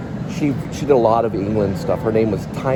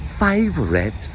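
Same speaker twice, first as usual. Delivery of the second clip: drawn-out London "Favourite", pitched high; London accent; 7 semitones higher, slower than usual. Retold or imitated. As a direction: imitate her London English, stretching the word high. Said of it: "I love her accent".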